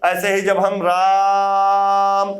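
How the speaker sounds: राम drawn out very slowly as a call; calling a man named Ram, long pluta vowel. Shown as a demonstration. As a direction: stretch राम like calling someone far. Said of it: "पुकारेंगे जोर लगाकर, चिल्लाकर".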